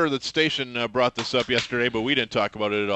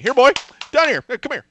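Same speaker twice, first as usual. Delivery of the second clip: high, loud dog call; 11 semitones higher, 8 dB louder than usual. Acted. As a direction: sing out to the dog. Calling the dog on air.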